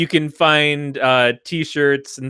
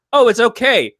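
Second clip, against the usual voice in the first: higher, louder fake comfort; mock reassurance; 7 semitones higher, 5 dB louder than usual. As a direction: reassure him up high. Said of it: "And she's like".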